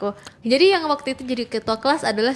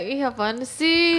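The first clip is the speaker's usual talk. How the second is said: slow, drawn-out mock disdain; classmates' mock annoyance; much slower than usual. Classmates react to the shouting president, drawn-out mock annoyance. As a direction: stretch it out like a fed-up classmate.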